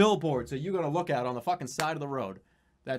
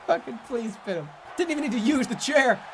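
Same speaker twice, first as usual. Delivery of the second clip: excited count; 9 semitones higher than usual. Real, not acted. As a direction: count the pin with rising glee.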